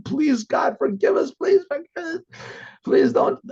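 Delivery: high, pleading cry to God; desperate prayer. Retold or imitated. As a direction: beg God tearfully for forgiveness. Captives screaming and crying to God.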